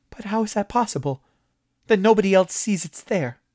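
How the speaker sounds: a nervous man begging for answers; alarmed high questions. A narrator's reading. Moss alarmed.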